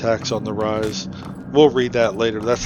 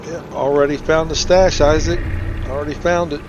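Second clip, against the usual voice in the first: higher and louder, teasing the dead man; teasing retort; 5 semitones higher, 5 dB louder than usual. Real, not acted. Player teases the dead Isaac about his stash, smug higher retort. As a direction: rub it in with a smug grin.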